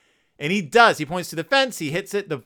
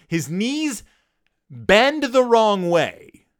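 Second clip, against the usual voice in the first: bend the wrong way dragged out slowly and louder; slow, louder stress on the wrong way; slow emphasis; 5 semitones higher, 5 dB louder, much slower than usual. Real, not acted. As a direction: linger on the gruesome bit. Graphic comic emphasis.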